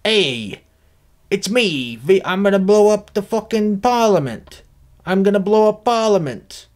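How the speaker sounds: a hint of a theatrical anarchist; V for Vendetta voice. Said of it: "How does V for Vendetta sound?".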